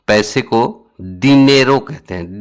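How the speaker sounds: ordinary talk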